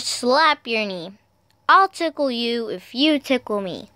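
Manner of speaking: usual speaking voice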